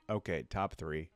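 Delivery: normal talking voice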